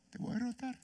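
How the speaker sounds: tiny high, hushed voice; not out loud. Shown as a demonstration. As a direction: squeak it timidly, barely audible. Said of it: "¿Es a viva voz? No".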